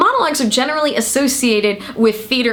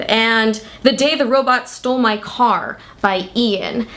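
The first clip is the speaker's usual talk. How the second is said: ordinary delivery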